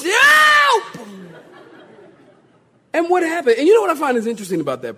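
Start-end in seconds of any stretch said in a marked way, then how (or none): 0.0-0.8 very high, loud scream for help; stretched, hammed-up drowning yell; desperate cry. Peter sinking in the waves, desperate high scream. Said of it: "he's sinking".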